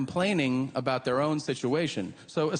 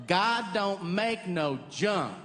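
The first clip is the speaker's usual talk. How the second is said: Southern drawl; 5 semitones higher, much slower than usual. Retold or imitated. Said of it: "someone will go".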